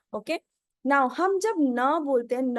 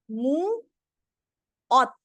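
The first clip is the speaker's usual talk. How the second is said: मुओत very slow, stretched "mu", a gap, then louder "ot"; syllable by syllable; 5 dB louder, much slower than usual. Shown as a demonstration. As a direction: draw out and split मुओत for learners. Teacher splits word into syllables.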